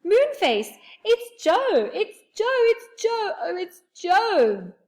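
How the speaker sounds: high, loud excited calls; yelling out. A narrator's reading. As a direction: shout through the door. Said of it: "yelled out loudly".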